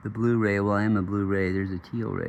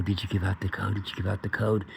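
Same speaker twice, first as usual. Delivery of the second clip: alien voice; 5 dB quieter than usual. Retold or imitated. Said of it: "they sounded kinda like aliens".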